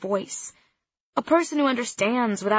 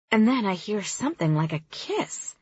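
plain reading voice

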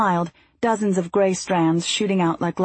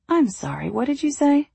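playful mock deafness; teasing; 7 semitones higher than usual. A narrator's reading. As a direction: tease him, feigning not hearing. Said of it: "I teased".

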